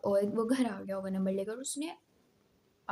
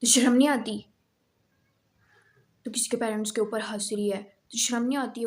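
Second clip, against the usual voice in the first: loud scolding shout at a student; yelling teacher; 7 dB louder than usual. Retold or imitated. PT teacher yelling at a student, angry loud shout. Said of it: "मेरे ऊपर चिल्लाने लगते हैं".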